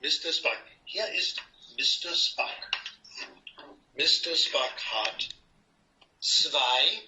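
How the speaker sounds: slow, clear words for a beginner; slow teacher talk. Shown as a demonstration. Teaching Roz German, slow and clear teacher talk. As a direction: spell it out slowly, like a patient language teacher. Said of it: "I want to give you a language lesson".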